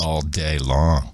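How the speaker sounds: slow, very low, drawn-out echo; mock sultry voice. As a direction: stretch it out deep and teasing.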